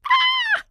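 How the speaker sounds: very high, loud shriek of fright; deafening shriek. A narrator's reading. Pup on breaking ice, shrieking in fright. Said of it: "with a deafening shriek".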